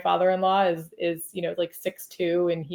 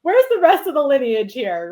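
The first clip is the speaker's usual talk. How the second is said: mock demand; 6 semitones higher, 6 dB louder than usual. Teasing husband about his family.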